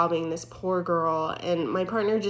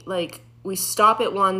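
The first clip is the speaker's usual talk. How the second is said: ordinary talk, nothing marked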